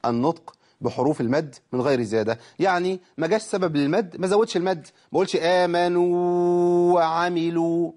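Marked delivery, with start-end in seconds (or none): 5.4-7.9 آمنوا وعملوا chanted with the vowels stretched far too long and higher; overlong madd, wrong. Shown as a demonstration. Teacher showing a wrong reading, long drawn chant. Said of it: "ما ينفعش كده، زودت الزمن زيادة".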